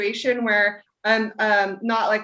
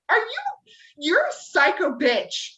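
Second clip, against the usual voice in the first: higher, drawn-out incredulous outrage; going off; 8 semitones higher than usual. Retold or imitated. Friend retelling how they went off at the wife, indignant. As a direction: retell it indignant and appalled, pitched up. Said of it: "And I went off".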